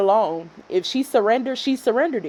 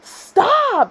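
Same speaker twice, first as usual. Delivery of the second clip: high, drawn-out yell; retold angry yell; 12 semitones higher than usual. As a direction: imitate a husband yelling really loud. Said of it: "he yelled really loud".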